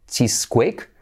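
CHEESEQUAKE as "CHEES-kwake", rising; guessed pronunciation. Retold or imitated. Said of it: "Yo hubiera dicho".

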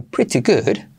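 English pretty good with clear t; British crisp-t pretty good; British accent. Shown as a demonstration. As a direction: say it as in England. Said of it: "igual en Inglaterra dirían".

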